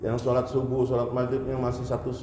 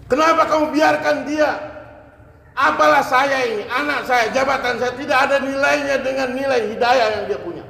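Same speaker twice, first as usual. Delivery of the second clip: much higher and louder, an enraged rebuke; furious Umar; 9 semitones higher, 7 dB louder than usual. Retold or imitated. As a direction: roar at him for not saying yes. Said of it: "Langsung Umar marah". (Umar membentak dengan marah besar)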